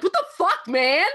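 high, incredulous snap with a laugh; indignant retort. Retold or imitated. To the bike cop at her window, an indignant snap. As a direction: throw it back at him, outraged. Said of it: "I was like".

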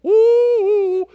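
high loud woo; cheering about Swiss cake rolls; excited whoop. Real, not acted. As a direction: cheer at the treat. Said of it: "you were rich".

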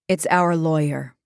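grave, understated character voice; solemn, contained announcement; great seriousness. A narrator's reading. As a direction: deliver the news very seriously. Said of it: "Joyce said with great seriousness".

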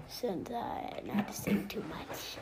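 soft unvoiced whisper trailing off; whispered aside. Real, not acted. Child admitting she talks too much, whispering. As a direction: tuck it away as a shy afterthought.

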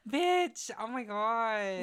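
very high, overwhelmed exclamation; gasp, then cry out to your friend; getting emotional. Real, not acted. Reacting to friend's offspring test, emotional. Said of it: "I'm getting emotional".

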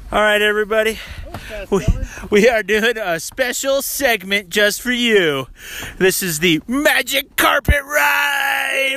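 loud, high, slowed show-host announcement; hammed-up announcer introducing the Magic Carpet Ride. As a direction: present the segment like a TV host. Announcing a segment on camera.

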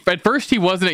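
usual speaking voice

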